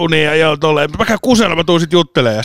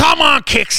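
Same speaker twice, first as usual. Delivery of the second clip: much higher, mock terrace shout; mimicking a shouting fan; 12 semitones higher, slower than usual. Imitating the fan who yelled at the match, high mock shout. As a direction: belt it out like a fan in the stands. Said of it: "äijä huuteli".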